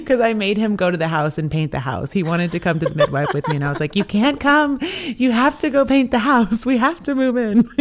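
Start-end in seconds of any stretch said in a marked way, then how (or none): 4.0-7.7 much higher, louder mock-bossy orders. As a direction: play it like a bossy wife giving orders, half-laughing. Bossing husband to paint house.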